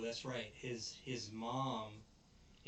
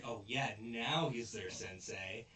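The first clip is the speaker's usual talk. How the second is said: normal talking voice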